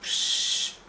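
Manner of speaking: a soft unvoiced psst to get attention; breathy psst before an offer; whispered psst. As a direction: hiss a quiet psst like a sly doctor. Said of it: "he'll give you an injection".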